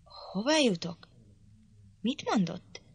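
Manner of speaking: a touch of scandalised disbelief; shocked. A narrator's reading. Catherine shocked at Hareton's curse, disbelieving. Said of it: "kérdezte Catherine megütközéssel".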